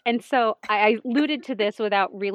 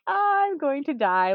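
slow delighted I'm going to die; 7 semitones higher, 4 dB louder, slower than usual. Retold or imitated. Reacting to the vow.

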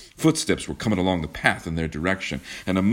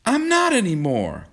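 high, forceful retort; fierce cry; 4 dB louder than usual. A narrator's reading. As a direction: fling it back fiercely. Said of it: "cried John fiercely".

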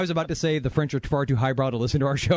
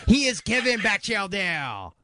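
higher, fast cartoon French accent; Pepe Le Pew French; 6 semitones higher than usual. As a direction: toss it off like a cartoon French skunk. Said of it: "I didn't know we had Pepe Le Pew on here".

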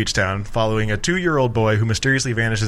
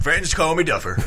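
cheesy TV character; 6 semitones higher than usual. Pretend. Continues the character bit.

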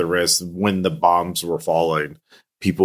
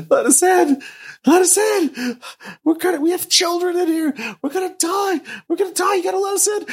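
very high, shrieking pleas; desperate screaming; faster than usual. Acted. Trapped families beg the player to open the doors, screaming. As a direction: scream to be let in.

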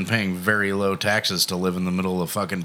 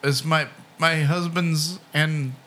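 put-on elderly singsong; 8 semitones higher, slower than usual. Mimicking the old lady speaker.

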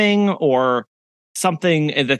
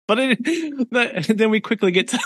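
giggling through the words; reading aloud through laughter; laughing while talking; 8 semitones higher than usual. Real, not acted.

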